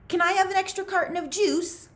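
high, fast wheedling ask; high, quick plea to Mister Sir for juice; eager Zigzag. A narrator's reading.

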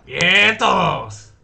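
loud cheer to everyone. Real, not acted. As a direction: call out a happy greeting. Cheering at game.

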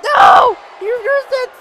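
high, loud shouts of disbelief; yelling in dismay; outraged protest. Real, not acted. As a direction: cry out as it all goes wrong. Move reversed in game.

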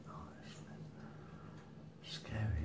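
ordinary talk

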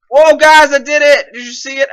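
loud, high cheer; excited triumph. Real, not acted. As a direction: crow about it to the viewers. Celebrates making the jump.

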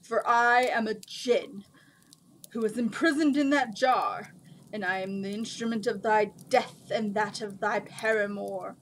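loud, grand djinn proclamation; measured, booming declamation; booming giant. A narrator's reading. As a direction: announce doom like a huge genie. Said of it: "in a booming voice that filled the cave".

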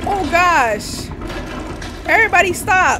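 high, very loud cry; panicked shout. Real, not acted. Player rushed by game figures, panicked shout. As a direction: shout them to a halt in alarm.

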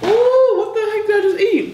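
high-pitched, startled ooh; surprised shriek. Real, not acted. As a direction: yelp as the spice hits. Bites hot food.

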